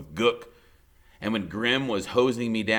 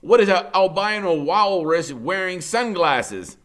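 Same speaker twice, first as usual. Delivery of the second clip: mocking beachgoer; 9 semitones higher, 9 dB louder than usual. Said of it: "you'd say".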